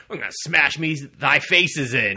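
loud, high mock warrior threat; bombastic warrior. Thor-like hero narrating his own attack, hammed-up loud boast. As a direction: boom it like a hammy hero.

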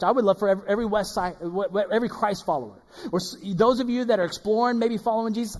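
usual speaking voice

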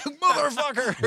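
long, very high, loud mock outburst; furious yell. Perry Saturn losing it at Sandman, slow high furious yell. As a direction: blow a gasket at the clueless partner. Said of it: "And he'd be like".

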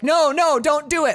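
high, loud mock plea; hammed-up desperate refusal; panicked protest. Acting a patient refusing amputation.

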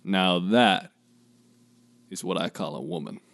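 low, soft Southern drawl; Southern belle. Pretend. Co-host joining the Southern act, Southern belle drawl. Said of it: "both turn into, like, Southern belles".

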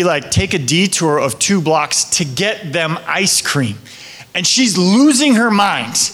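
ordinary delivery

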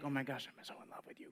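soft, fast, lovestruck sigh. Retold or imitated. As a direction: breathe it like a smitten teen.

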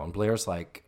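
ordinary delivery

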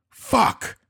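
high, loud curse; alarmed exclamation. A narrator's reading. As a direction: blurt it in shock. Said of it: "he exclaimed".

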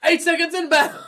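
mock radio warning of incoming danger; mock alarm call. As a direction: announce it like a panicked lookout. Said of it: "so Trent's like".

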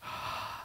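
acted-out heavy, breathy sigh; re-enacted sigh of dread; dismayed sigh. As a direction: let out a soft, helpless dad's sigh. Dad's reaction, acted out.

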